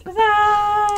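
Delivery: high, mock-heroic huzzah; triumphant cheer. Real, not acted. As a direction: cry victory like a knight. Triumphant when picked up.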